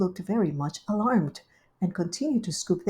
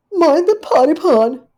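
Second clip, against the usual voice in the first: high, loud anxious blurt; Duchess's voice; 10 semitones higher, 12 dB louder than usual. Acted. Duchess warning Rivy about the pie, high anxious blurt. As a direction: blurt it out in a fluster.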